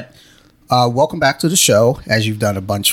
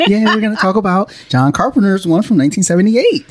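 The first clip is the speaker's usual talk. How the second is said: high, excited mock fan voice, rising at the end; hammed-up excited fan imitation; 11 semitones higher, 5 dB louder than usual. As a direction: send up the eager fans in a squeaky gush. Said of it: "Y'all was like".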